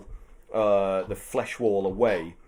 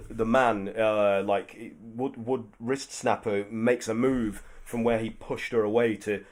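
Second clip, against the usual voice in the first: usual speaking voice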